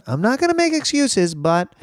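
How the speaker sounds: very high-pitched mock quote; mock excuse-maker. Podcaster mimicking people before they make an excuse, high mock voice. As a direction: imitate someone squeaking out a disclaimer. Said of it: "that's what people say right before they make an excuse".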